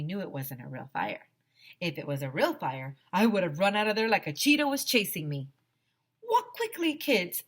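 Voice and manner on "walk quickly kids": teacher's order. Acted. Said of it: "Mister Cooper told us".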